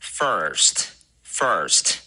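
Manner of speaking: first, first, slowly with a strongly curled R; curled-tongue R. Shown as a demonstration. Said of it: "要卷舌".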